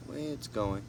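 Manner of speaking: ordinary talk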